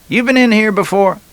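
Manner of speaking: a put-on bartender asking; Larry eyeing a stranger, high put-on voice; barman voice.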